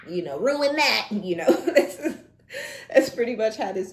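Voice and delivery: bursting into high loud laughter while talking; laughing. Real, not acted. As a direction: laugh through the words, real and loud. Laughing mid-reading.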